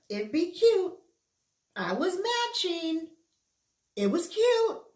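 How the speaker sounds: cutesy gush. Acted. Gushing over her lounge wear.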